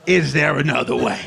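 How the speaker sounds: low, sly bribe offer; shady dad voice. Retold or imitated. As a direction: slide it across like cash.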